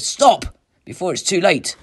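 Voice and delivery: high, loud alarm; forceful last-moment warning; urgent warning. A narrator's reading. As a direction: cry out to stop him. Inner voice warns him urgently.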